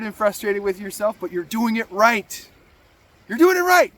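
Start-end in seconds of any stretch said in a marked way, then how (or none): 3.3-3.9 higher and louder, pushing the reassurance; emphatic encouragement. Acted. Vlogger urging a frustrated viewer on, emphatic lift. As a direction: fire up the struggling viewer.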